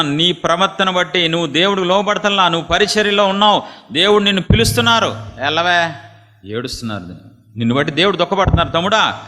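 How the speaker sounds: high, loud impassioned preaching; fervent preaching. Acted. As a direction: pour your heart out to the congregation. Fiery exhortation. (ఉద్వేగంతో గట్టిగా ప్రసంగం)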